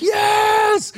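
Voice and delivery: very high shouted yes; desperate yell. Retold or imitated. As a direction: scream it, desperate. Desperate answer.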